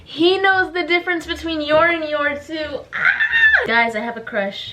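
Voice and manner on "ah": high delighted squeal; shrieking with glee; excited squeal. Real, not acted. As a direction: squeal like a giddy fan. Squealing over a crush.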